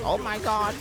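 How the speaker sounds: high-pitched mock gasping reaction; put-on scandalised voice; mock shock. Mimicking people fussing over voice cracks.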